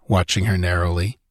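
ordinary delivery, nothing marked

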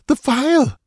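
gasped cry. A narrator's reading. Dancer repeating her plea for the phial, strained high gasp. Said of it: "she gasped".

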